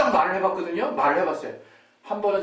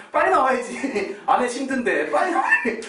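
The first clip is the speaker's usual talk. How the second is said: high, loud, laughing self-urging; half-laughing inner voice willing the baby out; 8 semitones higher, 4 dB louder than usual. Retold or imitated. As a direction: laugh while urging the baby to hurry, pitched up. His own anxious inner voice.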